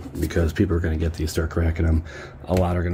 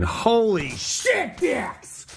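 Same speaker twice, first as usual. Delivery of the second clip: high, slow drawn-out disbelief; stunned exclaim; much slower than usual. Real, not acted. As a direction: gasp it out stretched and high. Shock at big pull.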